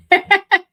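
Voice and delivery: sudden high laugh, loud; high burst of laughter. Real, not acted. Teacher laughing at her talking too fast, loud high laugh. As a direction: laugh at your own confession.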